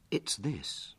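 hushed, confiding tone. A narrator's reading. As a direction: drop the volume to share a secret. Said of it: "lowering his voice".

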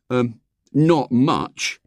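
put-on slow, savoured boyish catchphrase; proud. A narrator's reading. William using his borrowed expression, proud. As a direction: show off the new phrase, drawing it out. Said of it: "he added proudly".

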